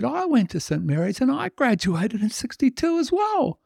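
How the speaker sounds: very high, squeaky sozzled voice; drunk Irishman amazed. Acted. Drunk Irishman discovering a shared school, very high. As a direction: gush like a drunk finding a twin.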